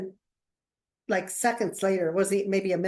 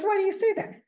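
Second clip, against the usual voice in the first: high-pitched mock puzzlement; high, put-on bewildered voice; baffled question; 13 semitones higher than usual. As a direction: imitate a thrown client, pitched way up. Client baffled by her remark, retold.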